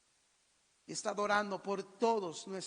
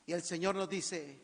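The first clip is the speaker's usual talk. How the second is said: usual speaking voice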